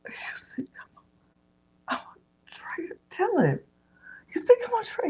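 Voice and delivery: halting breathless whisper leaping to a high squeak; mock shock. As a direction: act out stunned betrayal, whispered then squeaked. Retelling Danielle's shocked reaction.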